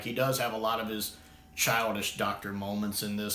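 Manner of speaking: normal talking voice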